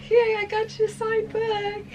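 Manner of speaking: very high, squeaky little yay; feeble mock cheer. Woman presents signed book after tracking mess in, mock cheer. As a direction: squeak out embarrassed celebration, hammed up.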